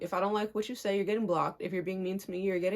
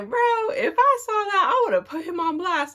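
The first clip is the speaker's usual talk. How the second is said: high, excited mimicry of dad; imitated indignant dad; 10 semitones higher, 3 dB louder than usual. Quoting dad's reaction to the situation, indignant. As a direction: exclaim it in imitated outrage.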